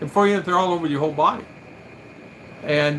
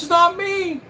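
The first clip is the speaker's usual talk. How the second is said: high, defensive doctor's reply; doctor protesting; 4 dB louder, slower than usual. Retold or imitated. Doctor denying blame for the cream shortage, high and defensive. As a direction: pitch it up, shrug it off. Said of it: "He goes".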